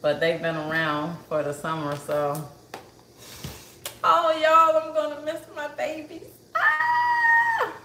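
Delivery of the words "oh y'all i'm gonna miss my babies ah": high, loud wail breaking into a cry; tearful wail. Real, not acted. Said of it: "I'm getting emotional".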